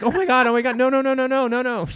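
imitating mom's frantic denial; panicked mom. Mom realizing she bid by accident: mock panicked protest. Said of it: "But she was like".

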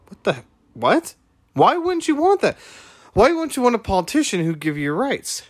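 mock stunned pitch jumping way up; bamboozled disbelief. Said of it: "She looked at me all bamboozled".

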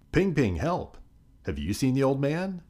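high-pitched, mild storybook yell; yelled loudly. A narrator's reading. Chang calling Ping Ping for help, a mild yell. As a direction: call for help with pitch well up. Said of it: "Chang yelled loudly".

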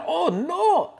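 high squeal of sudden alarm; hammed-up oh-no shriek; mock surprise. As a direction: gasp out a cartoon realization. Sudden realization in story.

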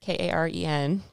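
K A R E N, letter by letter, each letter said separately; spelling it out. Shown as a demonstration. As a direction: spell out the name K A R E N one letter at a time. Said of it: "and that is spelled".

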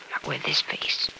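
slow, soft, despairing whisper; bitter whisper. Acted. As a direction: let it fall to a whisper. Ashamed of her scarred face.

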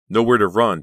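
cornered and desperate; panicked thought. A narrator's reading.

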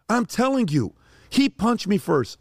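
put-on high, pleading boy's voice; son screaming upset. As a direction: plead like a kid in tears on the phone. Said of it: "I hear my son in the back screaming".

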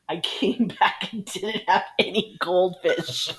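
slow, laughing through the punchline; can barely get it out for laughing; laughing while reading. Real, not acted. Reading a colleague's story, laughing through it.

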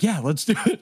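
a touch of fanboy eagerness; retold excitement. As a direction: act out an eager yes, lightly. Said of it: "he's all excited 'cause it's Elon Musk".

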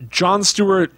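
ordinary talk

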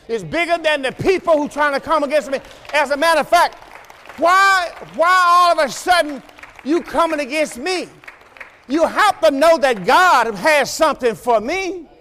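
preacher shouting; shouting preacher climax. Real, not acted. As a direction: rise to the peak of the sermon.

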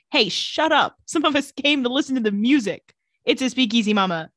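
a playful retold telling-off; mock snapping. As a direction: snap at the loud table, half in jest. Rebecca quoted snapping at them.

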